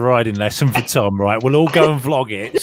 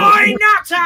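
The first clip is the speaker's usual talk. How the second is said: shouting horn; 7 dB louder than usual. Shown as a demonstration. Driver demonstrating a horn that insults other motorists, shouted. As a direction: yell it like a talking truck horn. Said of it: "haven't got to shout or swear".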